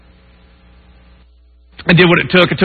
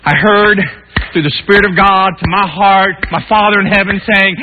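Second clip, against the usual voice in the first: usual speaking voice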